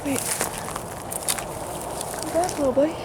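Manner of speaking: high soothing pet-talk voice; holding down a pet. Real, not acted. Calling to a startled deer.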